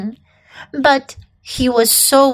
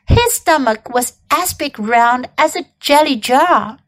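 usual speaking voice

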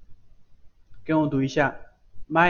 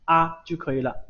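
usual speaking voice

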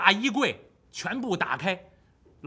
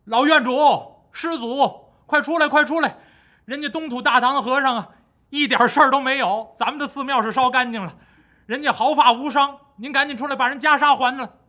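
higher and louder, shouting through the door; calling out; 6 semitones higher, 6 dB louder than usual. Acted. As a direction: yell for him to come out. Crowd calling abbot outside. (高声喊门)

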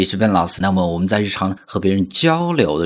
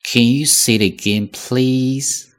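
please held long and rising; please 长音，真诚请求; long sincere please; 5 semitones higher, slower than usual. Shown as a demonstration. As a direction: lengthen please to ask sincerely. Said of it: "我们长音长一些".

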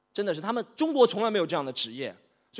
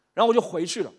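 ordinary delivery

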